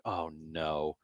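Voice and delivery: low, very soft mock dismay; quiet dismay. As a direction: mutter it to yourself, sinking low. Retelling his dread at sneezing.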